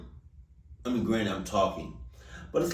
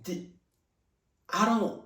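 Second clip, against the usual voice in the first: teary disbelief; 10 semitones higher, slower than usual. Real, not acted. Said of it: "my eyes are watering".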